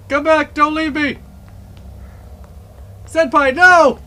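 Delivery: loud, high desperate pleading. Real, not acted. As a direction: beg them not to leave. Friend abandons him in game.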